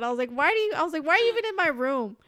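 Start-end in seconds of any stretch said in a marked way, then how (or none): 0.3-1.0 high, loud, indignant mock outburst; imitating an exasperated cry; exasperated disbelief. Acted outraged question to mom. 1.0-2.2 high, loud retold outburst; mock-outraged question, pitched up and loud; exasperated disbelief. Acted outraged question to mom.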